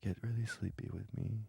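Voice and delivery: very soft bedtime hush; low sleepy talk. Shown as a demonstration. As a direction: lull them to sleep quietly. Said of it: "if I talk really low like this".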